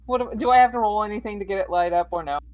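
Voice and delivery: usual speaking voice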